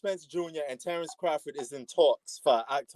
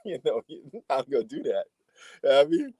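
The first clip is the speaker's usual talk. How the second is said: usual speaking voice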